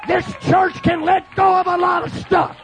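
impassioned, shouted revival sermon; full-force preaching. Acted. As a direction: preach it hard to a shouting congregation.

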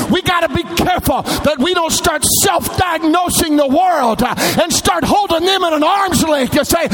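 high, shouted pulpit cry with drawn-out words; full-force preaching. Real, not acted. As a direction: preach it out at the top of your range.

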